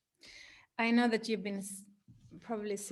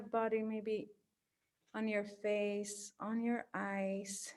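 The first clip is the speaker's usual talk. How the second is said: guided meditation. Acted. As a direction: gently guide them into their body.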